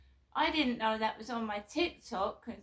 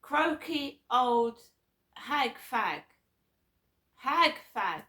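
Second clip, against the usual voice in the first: louder, slow, scornful quoting of the insult; 3 semitones higher, 8 dB louder, slower than usual. Retold or imitated. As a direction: spell out the slur with disgust. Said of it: "I will tell you what they said".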